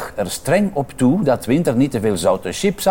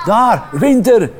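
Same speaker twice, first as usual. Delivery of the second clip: higher, louder, slow joyful shout; excited call; 9 semitones higher, 6 dB louder, slower than usual. Acted. Little child spotting Winter in the snow, joyful shout. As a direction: cry out the name with joy. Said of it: "riep een klein keeltje".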